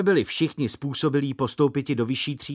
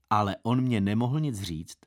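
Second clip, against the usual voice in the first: ordinary delivery, nothing marked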